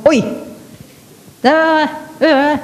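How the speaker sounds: a high, sharp "Oi!" then a careless "da, da, da"; the impatient wife calling her husband, high and sharp; harsh nagging wife. Retold or imitated. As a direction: bark it like a wife who always scolds.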